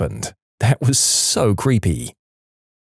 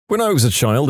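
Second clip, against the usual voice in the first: ordinary delivery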